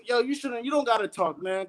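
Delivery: ordinary talk, nothing marked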